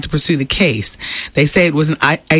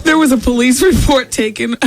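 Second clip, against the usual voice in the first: laughing; 7 semitones higher than usual. Real, not acted. Laughing while reading news.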